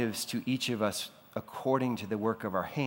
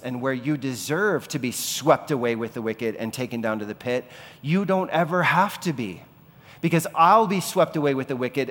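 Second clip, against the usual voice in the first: preaching at full force; 4 semitones higher, 6 dB louder than usual. Acted. Preaching Christ's substitution, resurrection.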